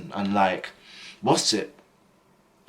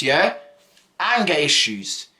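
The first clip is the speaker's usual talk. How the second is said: ordinary delivery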